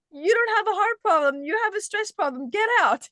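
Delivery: high, loud hammed-up mock of a dismissive doctor; mock him as he brushes you off and sends you out. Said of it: "He's just like".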